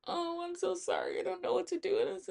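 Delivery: high, whiny imitation of a crying coworker; mock teary coworker. Mocking the crying coworker's apology to the narrator, high and whiny. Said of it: "She starts crying".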